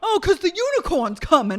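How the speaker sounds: high, loud hammed-up rant; mock sarcastic yell. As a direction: holler it as a mocking impression. Continuing imitation of shouted rant.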